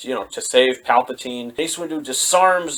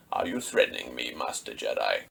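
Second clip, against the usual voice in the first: much lower, soft sinister emperor voice; menacing Palpatine; 14 semitones lower, 12 dB quieter than usual. Retold or imitated. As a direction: drop way down and menace the Jedi. Retold Palpatine confronting Mace Windu.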